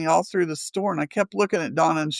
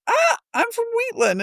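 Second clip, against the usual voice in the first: much higher, squealing with delight; excited squeal; faster than usual. Retold or imitated. Telling the shop lady her hometown, squealing. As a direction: squeal it like you've found a kindred spirit. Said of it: "And I went".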